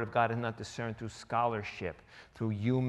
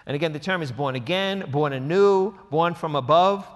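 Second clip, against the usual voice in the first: preaching emphasis; 4 semitones higher, 8 dB louder than usual. Acted. Preacher drives home key phrase.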